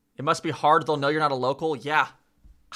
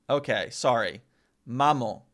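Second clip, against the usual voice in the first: ordinary delivery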